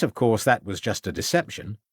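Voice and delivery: ordinary talk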